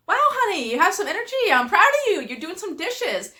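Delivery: high, bright praise that sounds overdone; retold excited praise. Wife retells praising husband for doing dishes, high and excited. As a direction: cheer him on a bit too much.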